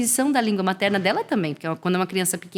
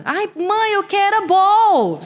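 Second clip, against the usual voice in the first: put-on much higher, slow little-kid voice; imitating a small child; 12 semitones higher, 5 dB louder, much slower than usual. Child asking mother for the ball, high kid voice. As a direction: whine it like a toddler asking mum.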